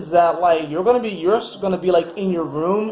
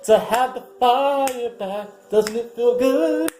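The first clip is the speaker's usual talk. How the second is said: high, slow, drawn-out relish; hammed-up blissful indulgence, pitched high; savoring indulgence; 6 semitones higher, slower than usual. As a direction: luxuriate in every stretched word. Voicing Sagittarius enjoying wine and chocolates.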